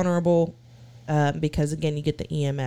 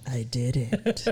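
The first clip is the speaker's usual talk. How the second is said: quiet ghost voice; 6 semitones lower, 6 dB quieter than usual. Retold or imitated. Imitating the EVP voice.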